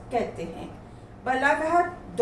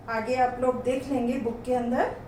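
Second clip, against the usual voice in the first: normal talking voice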